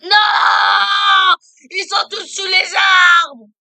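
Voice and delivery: loud, high panicked cry; panicked shout. Real, not acted. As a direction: yell in alarm at the mobs. Panicked about mobs under trees.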